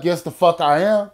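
acted-out argumentative reply; heated comeback. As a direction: fire back an insistent answer. Acted quarrel, answering back.

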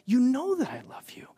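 whispered pleading reply; hushed Peter. Acted. Peter answering Jesus, whispered and earnest. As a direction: whisper it with hurt.

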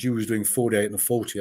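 usual speaking voice

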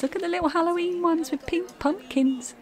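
high, delighted cooing. Real, not acted. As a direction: gush over something cute. Cooing over cute Halloween markers.

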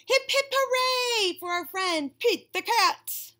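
high, loud, slow hearty cheer; jolly Santa cheer. Acted.

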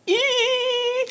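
thin, very high eee; long high-pitched squeak of excitement; excited squeal. Real, not acted. Excited while opening gifts.

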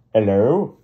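loud call into the distance. Acted. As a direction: shout it to someone far away. Calling out at airfield.

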